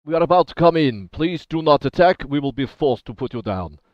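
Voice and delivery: firm, loud official warning; loud stern warning. Acted. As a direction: warn them off, loud and firm. Guard warns through door.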